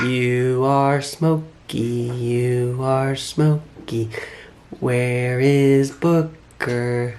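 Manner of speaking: slow teaching voice. Real, not acted. Father teaching baby family names, slow and drawn out.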